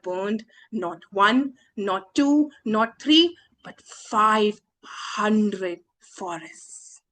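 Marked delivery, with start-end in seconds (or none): none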